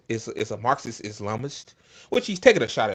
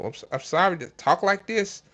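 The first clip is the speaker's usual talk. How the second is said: ordinary talk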